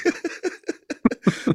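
high, wheezy burst of laughter; real amused laugh. Real, not acted. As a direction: laugh at your own punchline. Laughs at own anecdote's punchline.

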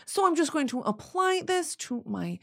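soft, affected vlogger parody; mock influencer voice. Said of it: "That's the bit".

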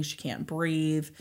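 usual speaking voice

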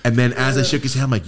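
ordinary talk

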